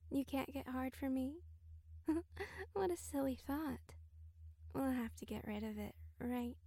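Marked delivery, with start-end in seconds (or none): 1.4-2.7 high light mocking giggle; playful amused giggle; teasing giggle. Teasing the listener.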